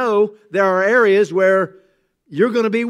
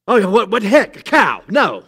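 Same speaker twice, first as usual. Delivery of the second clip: jolted, louder confused reaction; husband startled by his wife's yell; 2 semitones higher, 5 dB louder than usual. Retold or imitated. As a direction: caught off guard behind the wheel. Startled husband reacting while driving.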